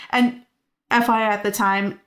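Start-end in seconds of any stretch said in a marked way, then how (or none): none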